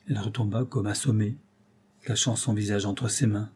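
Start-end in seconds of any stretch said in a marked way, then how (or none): none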